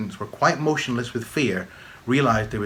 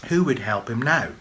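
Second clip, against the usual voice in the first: usual speaking voice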